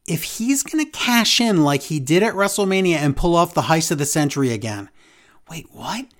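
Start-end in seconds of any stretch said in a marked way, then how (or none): 5.5-6.1 breathless whispered wait, what; whispered disbelief. Real, not acted. Hearing a baffling cash-in rumor, whispered disbelief. Reacting to commentators' cash-in claim.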